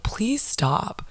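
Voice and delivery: soft, hushed mock plea; pained plea. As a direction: beg under your breath for quiet. Pained reaction to loud people.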